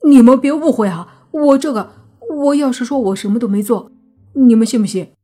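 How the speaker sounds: loud, urgent plea not to be misunderstood; flustered, anxious. A narrator's reading. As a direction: blurt out a panicky explanation. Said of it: "泥巴顿时急了". (着急慌张地大声辩解)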